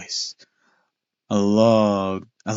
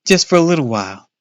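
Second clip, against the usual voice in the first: higher, fast pleading; 6 semitones higher, 5 dB louder, faster than usual. Acted. Boy joining brother to beg dad. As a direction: beg along quickly. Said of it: "I joined my brother in pleading".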